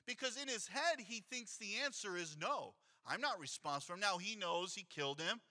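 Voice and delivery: usual speaking voice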